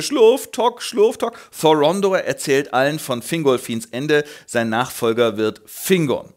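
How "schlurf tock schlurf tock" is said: limping noise. Acted. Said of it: "ich hinke".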